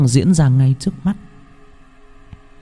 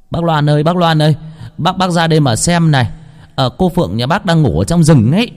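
higher, urgent calling out; calling villager; 7 semitones higher than usual. Acted. Villager running to tell Loan his daughter is found, urgent calling. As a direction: call Uncle Loan over from afar.

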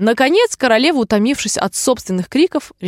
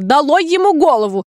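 higher-pitched angry royal order; shouted command; 6 semitones higher, 4 dB louder than usual. A narrator's reading. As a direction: cry out a sentence as a cross queen. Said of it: "ссорилась с другими игроками и кричала". (сердитый выкрик королевы)

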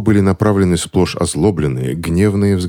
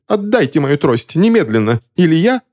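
high-pitched, outraged wail; yelping demand; 12 semitones higher than usual. A narrator's reading. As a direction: demand it in a high, frantic yelp. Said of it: "завопил мужчина в фетровой шляпе".

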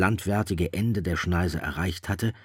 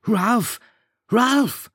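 high, loud pained scream of a name; Simon screaming; 9 dB louder than usual. A narrator's reading. Simon screaming for Ralph as if hurt. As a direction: cry out as if hurt. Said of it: "Simon schrie auf, als habe er sich verletzt".

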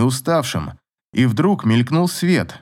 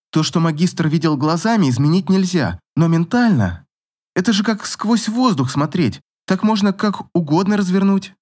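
put-on lightly raised girlish voice, keen; a bright girl explaining her idea; eager young girl; 7 semitones higher than usual. A narrator's reading. Girl character speaking eagerly.

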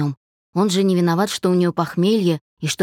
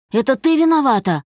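raised, higher-pitched accusing voice; louder accusation; 6 semitones higher, 3 dB louder than usual. A narrator's reading. Man blames his ex on the phone, raised angry voice. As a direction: throw the blame at her, voice up. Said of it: "ещё громче продолжил Олег".

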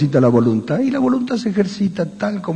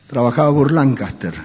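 ordinary talk

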